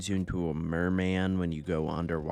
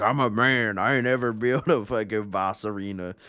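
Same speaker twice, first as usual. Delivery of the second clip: loud, puffed-up tough guy; macho man voice; 4 semitones higher, 7 dB louder than usual. Retold or imitated. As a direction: boom it out like a macho bro. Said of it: "He's like".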